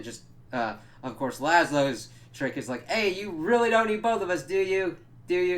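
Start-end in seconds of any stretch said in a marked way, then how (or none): 2.9-5.6 high, loud put-on appeal; loud mock pleading. Laszlo tries a trick on captors, loud pleading. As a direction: pitch it up and push it out.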